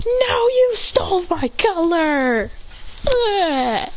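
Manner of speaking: high, drawn-out retort; mock indignation. Gladion arguing over outfit color, high indignant whine. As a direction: throw the accusation back, high and slow. Rival throws the accusation back.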